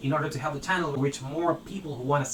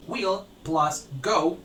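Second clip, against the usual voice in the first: slow teaching; 3 semitones higher, 4 dB louder, much slower than usual. Shown as a demonstration. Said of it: "teacher spelling out a formula".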